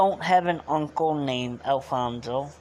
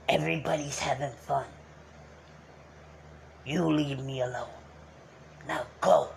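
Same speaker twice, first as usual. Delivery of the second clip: put-on old Uncle Alfonso voice. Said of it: "that was a long while talking like him".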